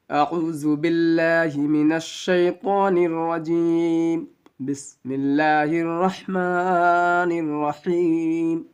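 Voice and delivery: slow, drawn-out chanted recitation; Quranic recitation. Shown as a demonstration. Teacher opening the Quran class, slow chanted recitation. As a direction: intone the opening formulas slowly and reverently. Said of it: "ایک بار آپ بھی اعوذ باللہ اور بسم اللہ ضرور کر لیں گے".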